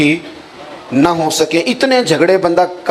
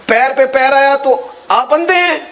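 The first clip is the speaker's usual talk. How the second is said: put-on indignant old man; 10 semitones higher than usual. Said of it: "کہا".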